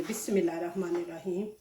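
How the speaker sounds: fast, soft under-the-breath bismillah; hushed quick blessing before flipping the paratha; murmured prayer. Real, not acted. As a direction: rattle it off quietly to yourself.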